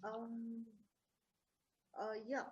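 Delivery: faint, far from the mic; barely audible. Real, not acted. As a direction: speak barely above a murmur. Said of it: "Your voice is, uh, very low, [name]".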